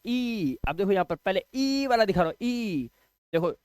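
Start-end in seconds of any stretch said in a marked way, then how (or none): 0.1-0.6 ई held long, pitched higher; lengthened ई, higher and sustained; long "ee" sound. Shown as a demonstration. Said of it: "लंबा sound लेके जाना है". 2.4-2.9 sustained, lengthened ई; long "ee" sound. Shown as a demonstration. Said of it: "लंबा sound लेके जाना है".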